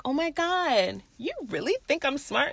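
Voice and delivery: soft, high flattered disbelief; touched, flattered. Retold or imitated. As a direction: coo it quietly, acting touched. Retells being flattered by coworker.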